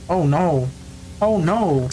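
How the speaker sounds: usual speaking voice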